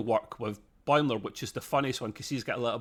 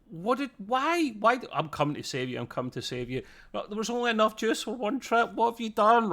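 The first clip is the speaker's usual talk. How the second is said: high-pitched, flustered retold protest; exasperated Boimler imitation, rising high; mock exasperation; 10 semitones higher than usual. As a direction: act out a panicked complaint. Voices Boimler protesting the botched rescue.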